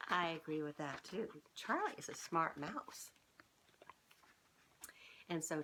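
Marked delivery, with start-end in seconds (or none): none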